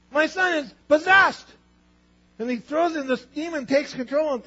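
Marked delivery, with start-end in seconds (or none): 0.1-1.5 high, loud, desperate plea; pleading father. Retold or imitated. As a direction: beg for your son.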